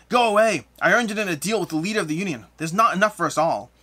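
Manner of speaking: loud, high crazed shout easing slightly; mad ghost. Acted. Ghost ordering intruders away from his food, mad and shouting. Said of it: "he stares at you with madness".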